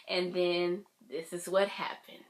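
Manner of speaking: ordinary talk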